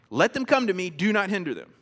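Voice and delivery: "Do not hinder them" landing hard and final; emphatic double command. Acted. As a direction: fire off "Let them come to me" and "Do not hinder them" as two orders. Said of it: "It's a double imperative. It's emphatic".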